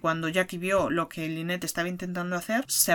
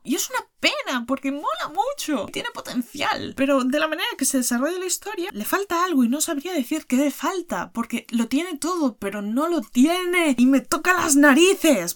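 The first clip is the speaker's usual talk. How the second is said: frustrated; 7 semitones higher than usual. Real, not acted. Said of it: "es frustrante".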